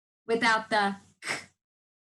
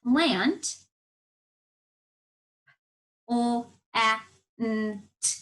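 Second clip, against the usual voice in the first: robot voice; slower than usual. Shown as a demonstration. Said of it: "It's almost as if you're talking like a robot".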